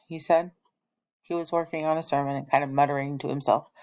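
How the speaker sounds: ordinary delivery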